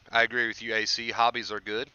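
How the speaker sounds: ordinary talk, nothing marked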